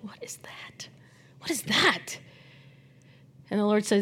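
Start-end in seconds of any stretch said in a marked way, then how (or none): 0.0-2.2 whispered. Retold or imitated. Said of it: "And I was like".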